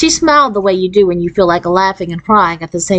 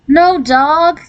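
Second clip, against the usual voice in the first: crying out; 9 semitones higher than usual. A narrator's reading. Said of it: "Jan cried".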